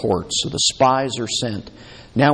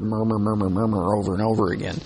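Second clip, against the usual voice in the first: crowd murmuring demo; 2 semitones lower, faster than usual. Shown as a demonstration. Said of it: "the word murmur sounds like a murmur".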